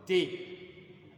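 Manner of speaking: "D" said the way Ghanaians say it, in Ghanaian English; Ghanaian pronunciation. Retold or imitated. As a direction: imitate the Ghanaian "D", set apart from the French. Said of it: "les Ghanéens, ils vont dire par exemple". (« D » prononcé à la ghanéenne)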